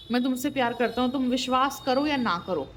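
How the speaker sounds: ordinary talk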